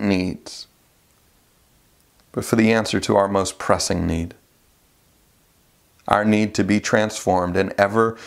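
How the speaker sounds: low, hushed prayer voice. Acted. Pastor leads a prayer.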